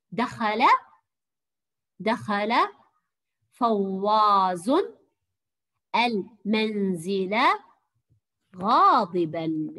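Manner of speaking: very slow, word by word, with pauses; slow dictation. Shown as a demonstration. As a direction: dictate each word slowly for the pupils to write. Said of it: "سوف أمليكم عبارة بسيطة". (إملاء بطيء كلمة كلمة)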